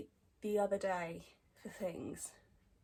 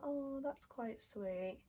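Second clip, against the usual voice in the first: high, slow, drawn-out cooing; touched reaction; 4 semitones higher, 4 dB quieter, much slower than usual. Retold or imitated. Vlogger reacting to apple-of-my-eye line, touched. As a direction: coo over it, stretching the words. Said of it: "I was like".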